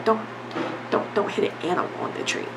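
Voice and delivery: breathy unvoiced whisper, dropped right down; whispered aside. Real, not acted. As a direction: toss it off under your breath. Hushed aside mid-rant.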